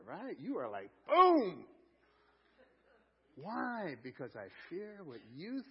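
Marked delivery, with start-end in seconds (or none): 1.1-1.7 loud, high, booming burst; sudden mock-dramatic boom; playful exclaimed boom. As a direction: punch it out like a sound effect. Acting out the selfie pose moment.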